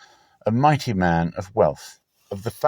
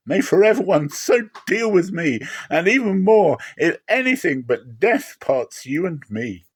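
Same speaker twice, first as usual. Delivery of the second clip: higher, fervent vow; devoted Ruth; 7 semitones higher than usual. Acted. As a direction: pledge loyalty with rising passion. Ruth's vow to Naomi.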